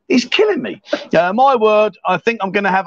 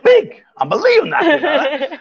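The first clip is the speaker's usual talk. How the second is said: excited; 8 semitones higher than usual. Real, not acted. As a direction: squeal with delight. Delighted, laughing over verdict.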